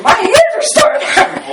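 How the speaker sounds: put-on high panicked kid voice; freaking-out kid. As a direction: voice the scared boy high and anxious. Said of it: "He starts freaking out, saying".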